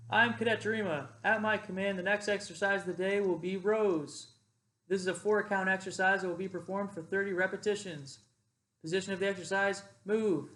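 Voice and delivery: projected parade-ground announcement; command voice. Shown as a demonstration. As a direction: call it out to the squad like a cadet leader. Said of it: "So I say".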